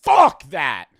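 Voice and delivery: sudden loud outburst of refusal; emphatic disgusted shout. Real, not acted. Host reacting to medical school plans, shouted rejection. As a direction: blurt it out loudly, punching the first word.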